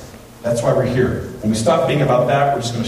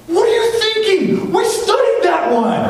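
very high, loud outraged yell from the back; mom yelling; 3 dB louder than usual. Retold or imitated. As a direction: scream it like a furious mother. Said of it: "this mom in the back yells".

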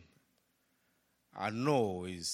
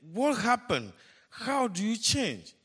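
high, loud amazed asking; astonished question; 11 semitones higher, 5 dB louder than usual. Retold or imitated. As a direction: ask it in pure disbelief. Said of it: "And they say".

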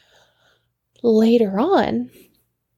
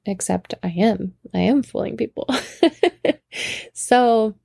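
plain reading voice

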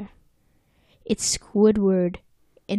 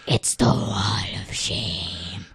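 slow, drawn-out stage whisper; ominous whisper; 12 semitones lower, 3 dB quieter, slower than usual. Acted. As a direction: whisper it slowly, like a dread announcement. Ominous whispered repeat of the phrase.

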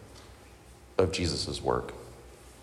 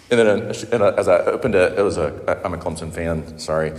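normal talking voice

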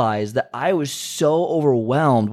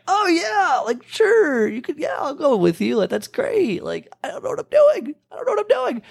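put-on much higher, squeaky voice of a nervous teen; panicky squeak. Teen agreeing to a gym trip with his buddy, high nervous squeak. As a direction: squeak it out like you're panicking inside.

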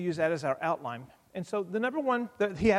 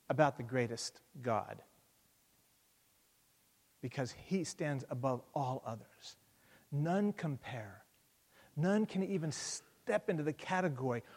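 much softer, slowing right down; sudden hush; 4 semitones lower, 7 dB quieter than usual. Acted. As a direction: let it sink in quietly. Drops after loud peak.

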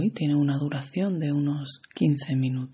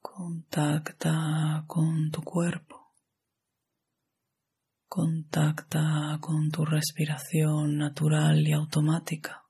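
guided meditation; 2 semitones higher than usual. Acted. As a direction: guide the listener into relaxation, unhurried and even.

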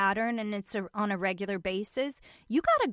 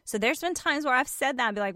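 usual speaking voice

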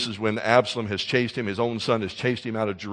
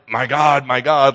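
mock angry outcry to God, louder; angry cry; 8 dB louder, slower than usual. Shown as a demonstration. Preacher shows the wrong way, an angry Jesus on the cross. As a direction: hurl it up as an accusation. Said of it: "like he's angry".

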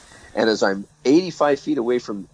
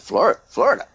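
high, stammered FLORIDA with a questioning rise; baffled question; 8 semitones higher than usual. Retold or imitated. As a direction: repeat Florida as if utterly confused. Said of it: "He said".

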